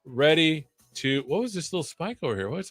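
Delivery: ordinary talk, nothing marked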